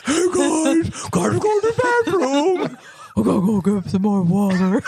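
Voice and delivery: hammed-up attempt at a guy voice; exaggerated mock guy voice; put-on man voice. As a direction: force a silly man voice through giggles. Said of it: "trying to sound like a man voice".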